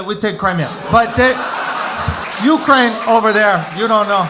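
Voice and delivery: put-on loud Russian-accented boast with a laugh; mock Russian accent. Comic boasting about Crimea in a Russian accent. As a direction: brag it in thick Russian. Same Russian character continues.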